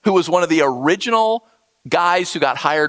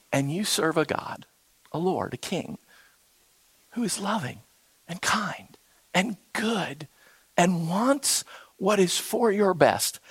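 hushed tender; 7 dB quieter than usual. Real, not acted. Tender close on God's love.

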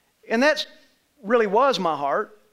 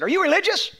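mock challenge; 10 semitones higher than usual. Jamaican guy challenging stranger.

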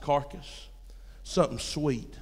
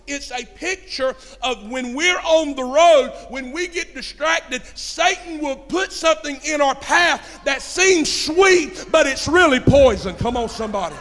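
high, loud, slow preacher's build; shouted sermon climax, drawn out; full-force preaching; 10 semitones higher, 10 dB louder than usual. Acted. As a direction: drive it home from the pulpit.

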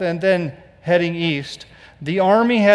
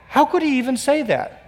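mock disbelief; 8 semitones higher than usual. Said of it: "you ought to stop here and go".

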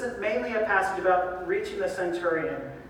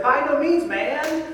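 casual brush-off; 5 semitones higher, 3 dB louder, slower than usual. Retold or imitated. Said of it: "Like if Peter said".

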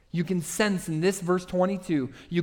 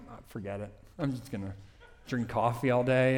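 low, soft, mock-defeated mumble to himself; resigned dismissal; 6 semitones lower, 6 dB quieter, faster than usual. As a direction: give up quietly, voice dropped. Acting his older, resigned self.

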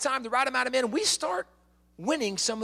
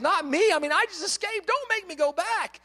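mock pleading; 6 semitones higher than usual. [name] feigning fear of returning.

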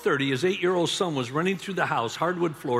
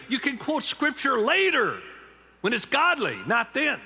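preaching emphasis; 8 semitones higher, 3 dB louder than usual. Real, not acted. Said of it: "preacher emphasis".

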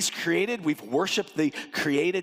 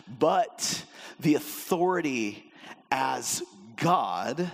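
ordinary talk

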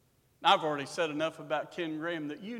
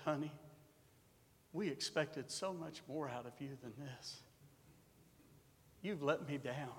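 soft, much quieter, let-down tone; mother gently scolding her son; disappointed mother; 2 semitones lower, 10 dB quieter than usual. Retold or imitated. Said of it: "my mother would say something like this".

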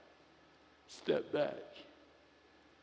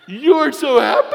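even higher and louder, laughing through it; louder laughing shout; 8 semitones higher, 11 dB louder than usual. Retold or imitated. Old lady repeats it down the aisle, laughing, louder. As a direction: belt it out again with a laugh. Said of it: "I wanted to hear her say it again".

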